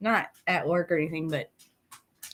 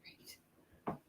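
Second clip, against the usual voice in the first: whispering; 25 dB quieter than usual. Shown as a demonstration. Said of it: "Okay, great".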